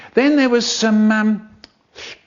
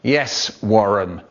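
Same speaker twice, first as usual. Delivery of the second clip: strict teacher. Acted. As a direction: keep a restless class in line, sternly.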